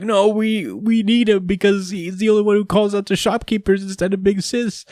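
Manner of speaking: lightly voiced sobbing boy; Gory pleads about his brother, mildly tearful; crying child. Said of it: "Gory starts to cry".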